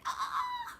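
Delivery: short, very high shriek of fright; sudden high mock scream; scared scream. Shown as a demonstration. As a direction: scream like something jumped out of the dark. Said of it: "this one where she's scared".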